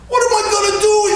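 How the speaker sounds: high, fast frantic whine; panicked question. Retold or imitated. Hurt friend running around the house, frantic high whine. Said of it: "He goes".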